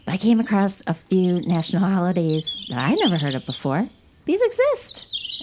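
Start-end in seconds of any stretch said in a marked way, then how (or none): 4.2-5.4 slow, high astonished drawl; amazed. Real, not acted. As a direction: stretch it out in disbelief. Amazed at odd holidays.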